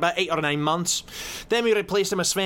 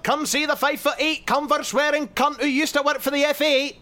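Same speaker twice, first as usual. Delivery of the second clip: carnival barker; 8 semitones higher than usual. Acted. Mock barker continues.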